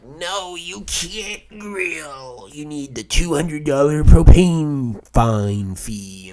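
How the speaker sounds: slow, drawn-out mock clerk voice, getting loud. Clerk refusing him without the propane fee, slow mock voice. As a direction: lay down the rule slowly like a smug clerk. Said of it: "they're like".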